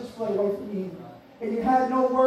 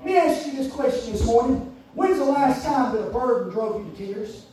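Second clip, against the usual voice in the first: normal talking voice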